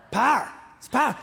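"Power" clipped to one syllable, pow'r. Shown as a demonstration. Pastor demonstrates the hymn's pow'r to the congregation. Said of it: "We take the E out".

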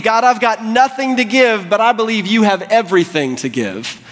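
high, impassioned prayer; fervent plea. Acted. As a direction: cry out to God in earnest faith. Leper's prayer, first take at full pitch.

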